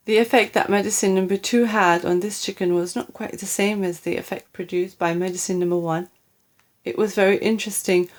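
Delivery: usual speaking voice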